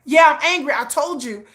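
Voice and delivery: loud, high retold indignation; mock anger. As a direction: snap back at a husband, playfully acted. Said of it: "Oh, you're angry?".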